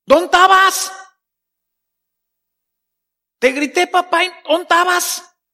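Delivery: hurt complaint to God; complaining prayer. Shown as a demonstration. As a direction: reproach your dad for not showing up. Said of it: "A quejarnos".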